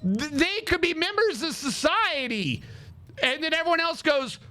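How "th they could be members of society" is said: higher, drawn-out mock plea; hammed-up do-gooder begging for mercy; mock pleading. Imitating girl pleading for trolls.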